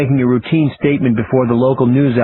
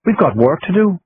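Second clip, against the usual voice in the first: put-on Irish accent; 7 semitones higher than usual. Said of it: "in her funny Irish accent".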